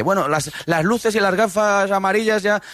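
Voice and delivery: imitación festiva en tono agudo; Mario Soriano. Retold or imitated. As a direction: cita actuada, sube bien la voz. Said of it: "Ahí ya decía Mario Soriano". (imita una arenga de celebración, más aguda)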